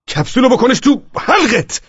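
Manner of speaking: high, slow, emphatic angry yell; furious outburst. Acted. Husband tells telemarketer where to put it, furious outburst. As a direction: lash out, stretching every word.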